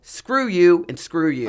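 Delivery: loud, slow, punched-out fury; retold angry outburst. Abandoned climber confronting the men who left her, loud anger. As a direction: re-enact telling each one off.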